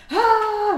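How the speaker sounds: long, high, loud "ah"; mock panic scream. Bather shows how she screamed going down, panicked mock scream. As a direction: re-enact a panicked scream at the icy water. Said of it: "Så jag skrek panik".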